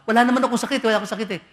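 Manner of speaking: high, loud insistent denial. Retold or imitated. Sick person loudly denying illness to visitor. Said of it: "sasabihin ng isang tao".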